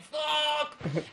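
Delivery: high-pitched howl of pain; retold screaming. Man clawing at his girlfriend's arms, screaming in re-enacted pain. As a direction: act out a high, agonised yell. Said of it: "I was screaming".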